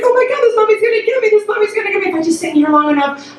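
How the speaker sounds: high, fast panicked babble; retold crying. Child hiding behind the car seat, high mock crying. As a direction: imitate a terrified child whimpering to herself. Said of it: "just crying".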